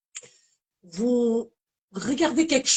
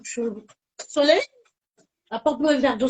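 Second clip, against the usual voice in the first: ordinary talk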